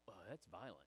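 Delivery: low, soft uneasy remark; mock disapproval. Congregation reacting to drowned Egyptians, low mock dismay. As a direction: murmur an objection, low and quiet.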